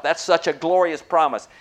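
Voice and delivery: ordinary talk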